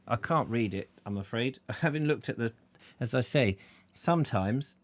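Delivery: usual speaking voice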